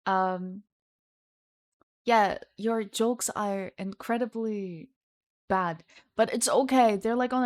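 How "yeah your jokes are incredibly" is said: normal talking voice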